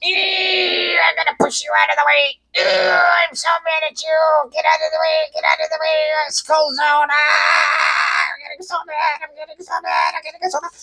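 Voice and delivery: very high, strained mad-driver squeal; hammed-up tailgater wailing through a tantrum; mock road rage. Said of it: "big truck right up this little old lady".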